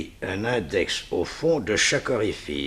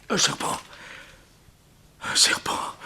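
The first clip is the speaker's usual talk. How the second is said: breathless whisper of dread; a frightened hush on spotting the snake; hushed horror; 7 semitones higher, 5 dB quieter than usual. Acted. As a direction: freeze and whisper in terror. Villain sees snake in box.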